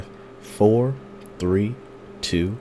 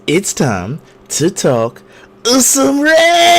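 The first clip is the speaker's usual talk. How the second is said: slow build into high loud yell; announcer yell; 5 dB louder, slower than usual. Acted. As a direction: bellow it like a ring announcer. Show catchphrase, building to yell.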